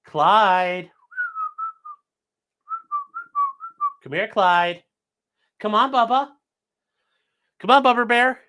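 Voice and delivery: high pet-talk coax; high coaxing call; calling the dog. Real, not acted. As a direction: sweet-talk the dog over.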